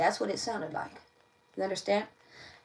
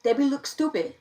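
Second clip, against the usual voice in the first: mock ignorant voice; 5 semitones higher, 7 dB louder than usual. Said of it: "Somebody could talk like that".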